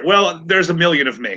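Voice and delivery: normal talking voice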